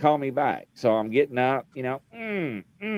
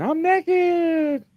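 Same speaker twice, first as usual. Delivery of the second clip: high, panicked mock squeal; high squeak. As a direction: squeal it high like they heard it. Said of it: "the people on the phone were like".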